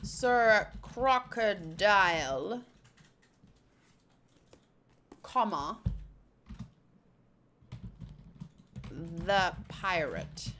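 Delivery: low, very slow title with long pauses; drawn-out, low reading of the title; deliberate title read. Acted. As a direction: spell out the pause after CROCODILE. Repeats title with spoken comma.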